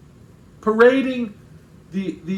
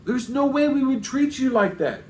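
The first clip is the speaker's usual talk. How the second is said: higher-pitched mock protest; sarcastic; 7 semitones higher than usual. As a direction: play sarcastic innocence, voice lifted. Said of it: "where [name] is sarcastic saying".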